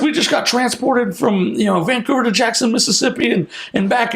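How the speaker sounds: high-pitched retold excitement; imitating an excited phone call; excited report. Retold thrilled phone call to mentor.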